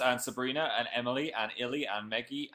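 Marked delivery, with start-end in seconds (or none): none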